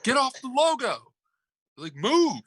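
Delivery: high, insistent mock yell; imitated shouting. Retelling a coach ordering a player to move, high mock shouting. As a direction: raise the pitch sharply, playing an irate coach. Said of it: "a shouting match and an argument pregame".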